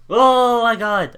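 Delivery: loud, high, drawn-out gasp of disbelief; shocked exclamation. Real, not acted. Streamer startled by sudden horror music, shocked loud outcry. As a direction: blurt it out, truly startled.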